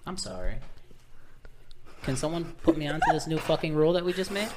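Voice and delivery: usual speaking voice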